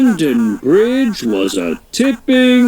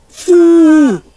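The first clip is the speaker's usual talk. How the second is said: loud, high spluttering foo; cartoon engine cough; sputtering jetpack noise; 13 semitones higher, 7 dB louder than usual. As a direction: blow out a sputtering engine sound.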